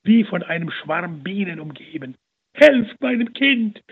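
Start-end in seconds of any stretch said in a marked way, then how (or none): 2.5-3.8 slow, high, loud desperate cry for help; a mother shrieking for her drowning child; panicked mother screaming for help; hysterical scream. A narrator's reading. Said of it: "schrie die Mutter hysterisch".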